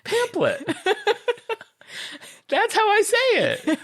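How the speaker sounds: very high, squeaky giggling defence; laughing protest. Real, not acted. Host defending own pronunciation to co-hosts, squeaking with laughter. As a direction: insist on it through real laughter. Defends word while cracking up.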